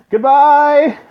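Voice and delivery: high, loud squeaky farewell; falsetto goodbye. Acted. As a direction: chirp goodbye in a put-on voice. Voiced character farewell.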